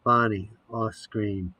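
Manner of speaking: usual speaking voice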